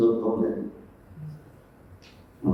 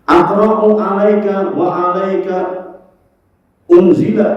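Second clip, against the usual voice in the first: emphatic Arabic quote; 6 semitones higher, 5 dB louder, slower than usual. Acted. Arabic hadith quote, loud.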